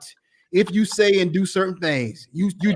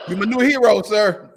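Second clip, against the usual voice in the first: ordinary talk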